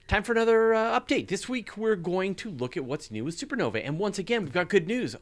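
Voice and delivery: excited intro. Acted. Segment intro, raised voice.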